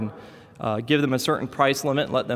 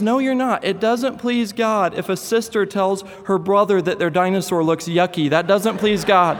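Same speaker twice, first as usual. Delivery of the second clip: put-on scolding little boy; 5 semitones higher than usual. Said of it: "And he says".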